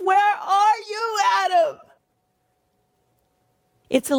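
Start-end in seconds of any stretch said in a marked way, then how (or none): 0.0-1.8 grieving lament. Acted. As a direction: wail it like a lament. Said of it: "expression of deep sorrow and deep grief".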